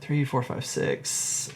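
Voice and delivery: ordinary delivery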